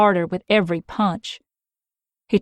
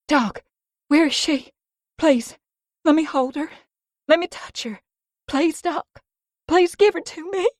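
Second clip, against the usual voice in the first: high, tearful pleading; desperate begging; 10 semitones higher than usual. Acted. Mia begging Doc to let her hold the baby. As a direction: beg for her child. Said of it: "I begged".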